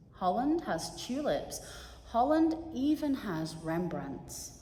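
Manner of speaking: expressive poem recital to an audience; heartfelt reading of a short poem. Acted. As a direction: read the poem with feeling, building the story. Reads a poem aloud.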